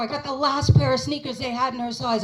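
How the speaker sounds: ordinary talk, nothing marked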